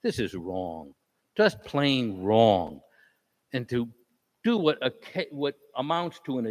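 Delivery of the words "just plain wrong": very slow, each word weighed; slow emphatic. Real, not acted. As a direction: drag it out with emphasis. Resident's emphatic protest.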